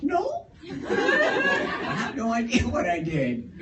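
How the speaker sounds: high, slow, laughing innocence; nervous laughing denial. Retold or imitated. Driver answering the cop, nervously laughing.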